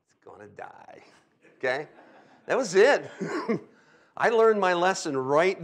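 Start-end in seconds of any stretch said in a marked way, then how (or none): 0.1-1.0 very soft, low deadpan; hushed grim vow. Retold or imitated. Driver giving himself the speech, quietly grim. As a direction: drop right down for the punchline.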